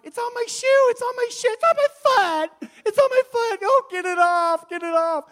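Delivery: very high, loud screeching lady; hammed-up falsetto screaming; panicked shrieking. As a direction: mimic a woman shrieking in disgusted panic. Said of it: "this one lady's going".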